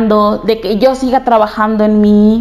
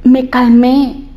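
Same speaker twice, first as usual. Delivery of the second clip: normal talking voice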